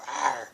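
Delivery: a loud mock growl like a lion; playful lion roar. Tarot reader teasing a Leo about his sign, playful mock roar.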